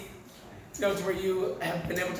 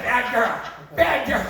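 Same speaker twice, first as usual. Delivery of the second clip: loud, higher-pitched telling-off; mock scolding; 4 semitones higher, 6 dB louder, slower than usual. Owner to a dog that misbehaved, mock scolding.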